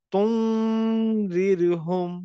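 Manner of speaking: তুনজিরহুম very slow, the n hidden in a long nasal hum; nasal ikhfa. Shown as a demonstration. Teacher modelling the hidden n, very slow. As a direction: stretch তুনজিরহুম, n through the nose. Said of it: "নাকের ভিতরের থেকে উচ্চারণটা করব".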